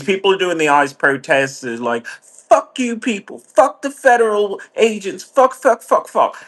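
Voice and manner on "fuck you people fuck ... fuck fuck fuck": mock protester rant. Mocking ICE protesters, a high hammed-up yell. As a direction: ham up an angry protester, voice pushed high.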